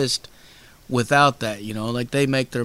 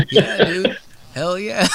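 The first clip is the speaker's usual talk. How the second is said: laughing through an imitated cheerful yes; playful laughing reply; laughing agreement; 5 semitones higher, 4 dB louder than usual. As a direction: chuckle out the yeah, dude. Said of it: "And I'm like".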